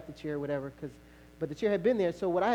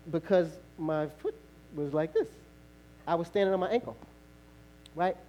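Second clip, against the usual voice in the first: ordinary delivery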